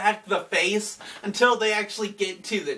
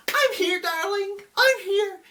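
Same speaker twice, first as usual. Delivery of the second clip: high, sweet, soothing mom voice; cooing mother; 13 semitones higher than usual. Retold or imitated. Mother soothing the child's voice, high and sweet. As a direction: coo it like a mother calming a child. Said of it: "She's like".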